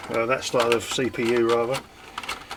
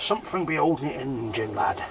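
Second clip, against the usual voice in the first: a hint of pirate swagger; lightly playing a ship's pirate; slower than usual. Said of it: "I'm talking like a pirate".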